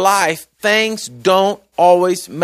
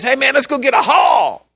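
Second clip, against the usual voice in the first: high-pitched, casual rallying call; eager call; 8 semitones higher, 4 dB louder than usual. Retold or imitated. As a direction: holler an invitation to a buddy. Preacher voices Jesus calling out casually.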